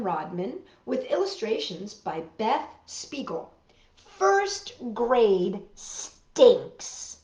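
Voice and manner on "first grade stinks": drawn-out, disgusted title read; disgusted whine. Acted. Child reader groaning the book's title. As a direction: drag it out like it smells bad.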